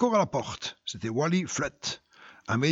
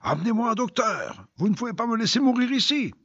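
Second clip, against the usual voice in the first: high, pleading cry for a doctor, faster at the end; dying man's cry; 8 semitones higher than usual. Acted. Dying drunk begs his friends for a doctor, desperate cry. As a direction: beg for help, voice pitched up. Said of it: "Jim Miller s'écria".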